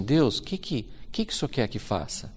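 soft, hushed words to God; quiet prayer. Retold or imitated. Prophet quietly asks God what to do, hushed. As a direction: murmur the question upward in prayer.

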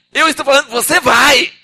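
loud, high insistent order; commanding God. Acted. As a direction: lay down the law as God.

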